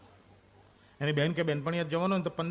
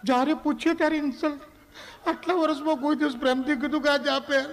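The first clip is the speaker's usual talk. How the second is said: wife's complaint; 9 semitones higher than usual. Acted. Wife complains in acted quarrel.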